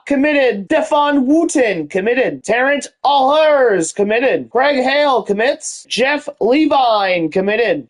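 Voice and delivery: high, drawn-out names like an arena announcer; hyped announcer. Acted. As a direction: stretch each name, drop on the commit. Announces recruits and season results.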